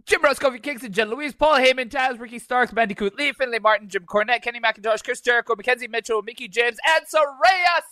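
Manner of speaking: high, fast announcer list in one breath; Excalibur announcer voice in one breath. Shown as a demonstration. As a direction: rattle off the roster without breathing. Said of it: "do it one breath".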